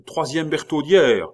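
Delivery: high, loud cry; urgent shout. A narrator's reading. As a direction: shout it out on the run. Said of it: "s'élança vers une tour en criant". (cri fort et pressé)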